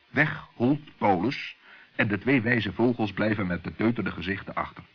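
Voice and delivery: ordinary delivery, nothing marked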